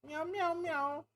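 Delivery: very high cat meows. Pretend. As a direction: meow like a little cat. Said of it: "be a meow meow cat".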